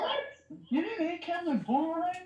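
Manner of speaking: high-pitched incredulous question; real disbelief. Real, not acted. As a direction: shoot up high, astonished. Friend reacts in disbelief.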